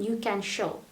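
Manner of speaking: ordinary delivery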